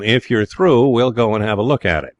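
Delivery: put-on brisk invitation in an American accent. A narrator's reading. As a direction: suggest it as an American would. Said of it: "in a marked American accent".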